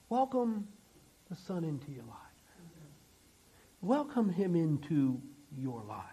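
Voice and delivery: very soft, low preaching, almost whispered in places; hushed altar invitation. Real, not acted. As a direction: gently invite them in, with long pauses.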